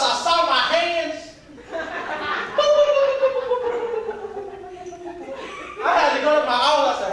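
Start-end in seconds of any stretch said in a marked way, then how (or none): 1.6-5.8 high laugh. Real, not acted. Laughs at his own story.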